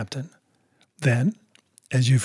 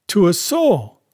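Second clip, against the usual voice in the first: emphatic, higher-pitched denial; protesting cry; 5 semitones higher, slower than usual. A narrator's reading. Squire finishing his denial to the captain, protesting cry. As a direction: swoop high on the last word in protest. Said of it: "cried the squire".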